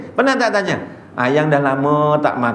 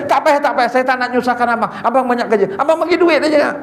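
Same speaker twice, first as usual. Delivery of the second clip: much higher mock beggar voice, laughing; wheedling beggar; 8 semitones higher, faster than usual. Beggar on a motorbike greets the ustaz, high wheedling mock voice. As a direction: sweet-talk the ustaz with a laugh.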